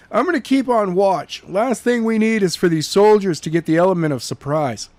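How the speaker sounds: brisk orders to the family; Skylar giving orders. Acted. Skylar telling Jenna's family to pack fast, urgent. Skylar says he'll keep watch.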